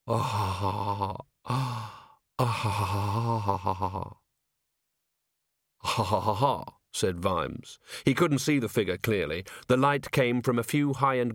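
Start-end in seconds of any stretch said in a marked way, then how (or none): 0.1-6.7 slow, breathy 'ah-ha-ha' chuckle; mirthless, drawn-out laugh in bursts; dry creepy laugh. A narrator's reading. Ancient herald amused at his own remark, creepy dry laugh.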